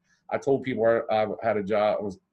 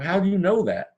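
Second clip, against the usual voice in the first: high, louder incredulous question; astonished; 9 semitones higher, 5 dB louder than usual. Retold or imitated. Retelling to a friend, asking the old superintendent, astonished. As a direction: recall the shock of a stranger knowing your house.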